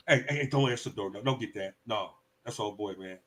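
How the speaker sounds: hushing the family so the cousin leaves; hushed urgent. Acted. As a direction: hide from the knock at the door. Acted scene hiding from relative.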